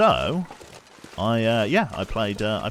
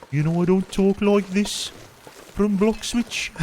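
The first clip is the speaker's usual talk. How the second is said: mock Brummie accent; 13 semitones higher, 6 dB louder, slower than usual. Shown as a demonstration. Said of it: "I can't even do a proper Brummy accent".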